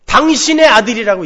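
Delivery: 당신의 stressed, loud and falling; cold disowning. Retold or imitated. Elder son disowning his brother to the father, cold contempt. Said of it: "이야기를 해요".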